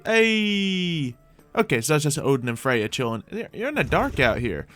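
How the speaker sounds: normal talking voice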